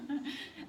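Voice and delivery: short run of soft chuckles; soft, amused little laugh. Real, not acted. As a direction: chuckle lightly at a student's wrong guess. Teacher amused by kangaroo guess.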